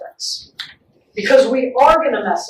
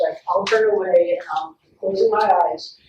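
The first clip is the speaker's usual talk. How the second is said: normal talking voice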